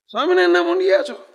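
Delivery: loud, high, fast jeer; a proud prince taunting shaven monks; sneering taunt. Acted. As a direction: spit out the insult at the monks.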